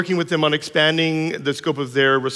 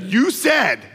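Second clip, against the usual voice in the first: son arguing; 8 semitones higher than usual. Retold or imitated. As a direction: argue like a clever child who found a loophole. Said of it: "He's like".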